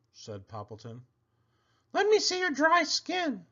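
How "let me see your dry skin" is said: much higher, bright llama voice; a llama giving friendly advice; Cherry Sue voice. A narrator's reading. Llama advising her friend the pig, high and sweet. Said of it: "said Cherry Sue".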